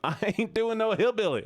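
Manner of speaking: a quick mock Southern hillbilly line; laughing, half-hearted hillbilly accent. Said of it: "A hillbilly redeem".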